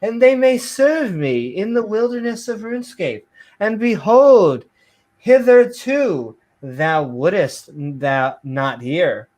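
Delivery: theatrical Bible reading; mock-biblical reading. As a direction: declaim it like hammed-up scripture. Mock King James reading.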